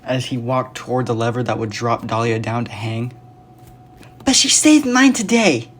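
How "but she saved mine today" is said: put-on much higher, loud little girl; child's scream. A narrator's reading. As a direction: shout it like a small kid. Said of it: "another young voice screamed out".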